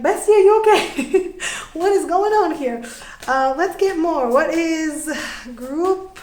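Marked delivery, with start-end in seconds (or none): 0.0-2.8 high, loud, laughing concern for a friend; laughing worried bestie. Retold or imitated. Reader voicing a friend who laughs through pain, laughing high.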